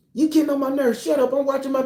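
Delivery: high-pitched irritated comeback; annoyed woman. Acted. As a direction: play the fed-up wife in a high voice. Acts woman snapping back.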